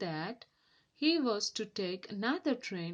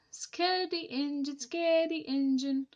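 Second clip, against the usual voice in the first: high chanting playground taunt; sing-song taunt; 7 semitones higher, 5 dB louder than usual. Acted. Giggling trucks taunting Henry, sing-song mockery. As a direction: chant it mockingly.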